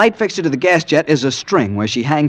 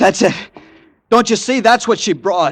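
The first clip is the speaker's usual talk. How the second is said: excited; 10 semitones higher than usual. Acted. Excited at finding the package.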